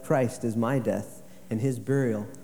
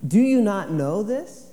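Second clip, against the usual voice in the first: slow, raised, louder question to the church; emphatic preaching; 9 semitones higher, 6 dB louder than usual. Acted. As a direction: let the question hang, slow and high. Quoting Paul, slow and raised.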